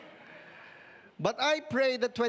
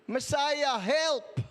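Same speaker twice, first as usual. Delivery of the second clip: raised, higher cry for help; imitated crying out; 5 semitones higher, much slower than usual. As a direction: call out to a saviour, mildly acted. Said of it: "they were crying out".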